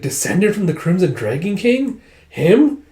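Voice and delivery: high, incredulous questioning; acted disbelief. Dragon doubts the king's bloodline, high incredulous question. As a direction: repeat it in disbelief, HIM shooting up. Listener repeats revelation in disbelief.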